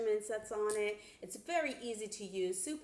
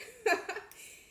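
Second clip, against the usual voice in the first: bright high burst of laughter; 13 semitones higher, 5 dB louder than usual. Real, not acted. To viewers after listing machine brands, high bright laugh. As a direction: laugh at your own list.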